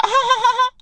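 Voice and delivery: very high-pitched imitated cackle; mock laugh. Girl laughs at his approach, high mocking laugh.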